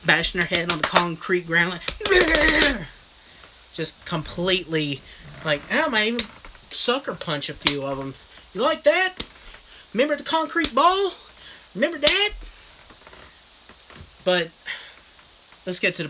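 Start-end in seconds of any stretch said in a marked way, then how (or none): 2.1-2.9 mock effort yell. Acting out bashing heads. 8.5-12.3 high, loud gloating put-on voice; hammed-up bully taunt; mock taunting. Imagined taunting of kids.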